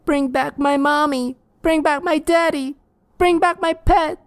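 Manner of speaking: put-on very high, loud child screams; ghost children screaming. Dead children screaming at the detective, very high. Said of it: "They all screamed out".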